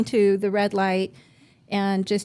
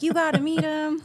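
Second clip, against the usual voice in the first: a hint of the husband's eagerness, pitched a bit higher; eager husband; 7 semitones higher than usual. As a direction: urge her to meet him, lightly higher. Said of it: "Yeah, he's like".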